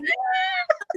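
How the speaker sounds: a high, delighted cry of recognition; overjoyed squeal. Retold or imitated. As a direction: squeal it like you just spotted your hero.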